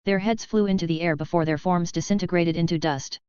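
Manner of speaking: usual speaking voice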